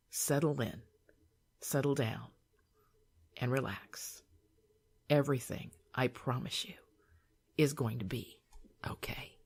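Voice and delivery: soft relaxation-script delivery; soothing hypnotist wind-down. Acted. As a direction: settle the audience down gently, dropping to almost nothing. Closing calm, hypnotic soothing.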